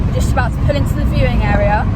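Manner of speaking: fast and loud over wind; shouting over wind. Real, not acted. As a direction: call it out quickly over wind. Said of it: "I hope you can hear me over the wind".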